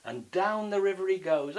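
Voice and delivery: plain reading voice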